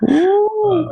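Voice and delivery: delighted oh. Real, not acted. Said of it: "reacting to the joke".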